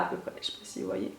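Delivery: murmuré pour soi, presque sans voix; chuchoté. Real, not acted. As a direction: petite remarque chuchotée en aparté. Aside while showing cut lashes. (chuchotement discret)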